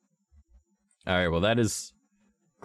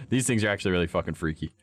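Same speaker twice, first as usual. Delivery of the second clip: normal talking voice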